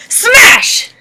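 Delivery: high, loud shout on one word; battle yell. Retold or imitated. Sister bringing the iron down on the roach, yelling. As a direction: yell it as you swing down. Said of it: "my sister takes the iron and goes".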